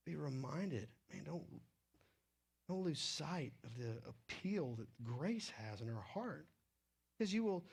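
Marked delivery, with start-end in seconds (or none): none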